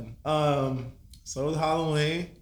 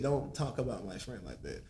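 lower, softer mock imitation of him; mocking the guy; 5 semitones lower, 10 dB quieter than usual. Mocking the friend who got offended, lower and softer. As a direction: quietly parrot the guy who tackled him. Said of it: "Legit, he said".

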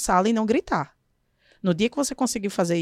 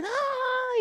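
high squealed no; a child's high mock shout of refusal; mock child yell; 4 dB quieter than usual. Teacher mimics boy shouting no at her. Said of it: "e começa a gritar".

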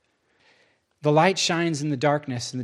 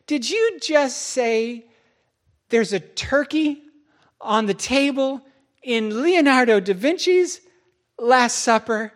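slow, higher disbelief; incredulous; 7 semitones higher, 3 dB louder, slower than usual. Retold or imitated. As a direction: spell out each phrase in disbelief. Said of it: "he says".

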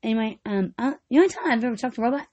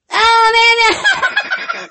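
loud exclamation; 9 semitones higher, 6 dB louder than usual. Real, not acted. Sudden outburst among laughing friends.

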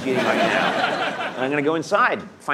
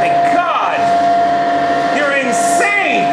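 high, loud shocked yell; shocked shout; 7 dB louder, slower than usual. Real, not acted. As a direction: shout it out in disbelief. Shocked at butcher machine.

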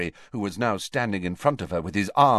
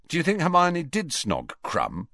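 sudden, higher-pitched query; abrupt question; 8 semitones higher than usual. Acted. Ron breaking Harry's daydream near the fat lady, abruptly. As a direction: blurt the question out of nowhere. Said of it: "Ron asked abruptly".

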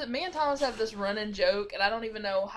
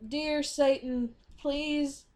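slow, higher, pleading prayer voice; mock prayer; 5 semitones higher, much slower than usual. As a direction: pray it slowly like a hammy preacher. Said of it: "I was being goofy".

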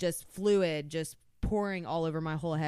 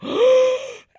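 loud, high startled gasp; sharp gasp; 13 dB louder than usual. Retold or imitated. On oxygen, remembering her cat, a loud gasp. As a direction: gasp as the thought hits. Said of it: "and I go".

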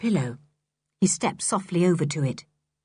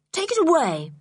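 sharp order; 9 semitones higher than usual. A narrator's reading. George sharply orders the meat away.

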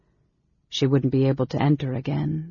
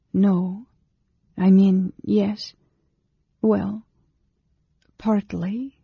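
higher, halting woman's voice; hesitant admission; 5 semitones higher, slower than usual. A narrator's reading. Anne Beryl admitting her reason to the mage, hesitant and high.

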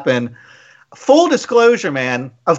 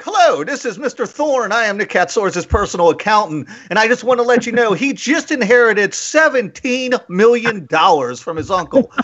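higher-pitched pompous phone introduction; imitating personal accountant; 5 semitones higher than usual. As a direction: play the grandly self-important accountant. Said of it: "hand this over to my personal accountant".

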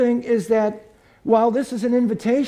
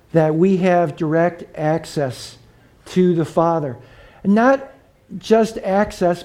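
ordinary talk

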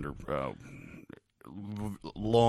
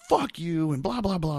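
retold yell; 9 semitones higher, 4 dB louder than usual. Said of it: "I'm yelling this as loud as I can".

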